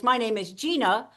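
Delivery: GINA set off before a long pause; pause before surname. Shown as a demonstration. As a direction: hold back after GINA. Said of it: "pause, pause, pause".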